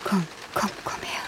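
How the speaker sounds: soft whispered come here; soothing hush to a crying sister; tender comfort. Acted. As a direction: draw her in gently, hushed. Sister comforting found sibling.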